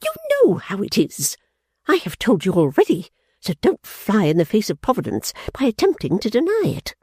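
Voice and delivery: high, sharp accusation; Fanny berating her sister; scornful scolding. Acted. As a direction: scold with contempt. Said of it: "cried Fanny".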